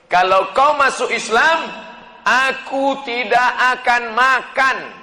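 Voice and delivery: high, loud ultimatum; mother's threat. Acted. Mother threatens her son for converting to Islam, loud threatening voice. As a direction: deliver it forcefully, as a warning. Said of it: "mengancam sahabat Nabi".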